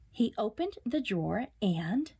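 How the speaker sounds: ordinary delivery, nothing marked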